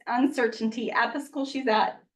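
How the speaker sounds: ordinary talk, nothing marked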